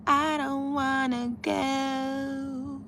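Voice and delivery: long, high, drawn-out whine; playful whine. Pretend. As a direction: drag it out slowly like a reluctant kid. Playfully repeats her reluctance.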